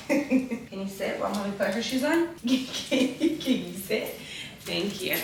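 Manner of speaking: coaxing dog talk while putting shoes on; pet talk to the dog. Real, not acted. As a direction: sweet-talk the puppy into sitting. Asking the dog to sit.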